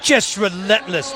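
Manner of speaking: ordinary delivery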